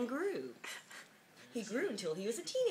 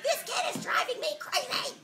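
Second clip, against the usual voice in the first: high, louder fed-up mum; a mother at the end of her rope, half laughing; exasperated mother; 6 dB louder than usual. Acted. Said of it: "Sometimes his mother would say".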